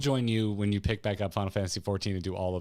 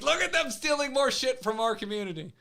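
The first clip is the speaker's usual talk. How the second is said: high-pitched imitated fan complaint; hammed-up fandom outrage, pitched way up; mock outraged fans. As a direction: whine it high like indignant fans. Voicing indignant fans in a quote.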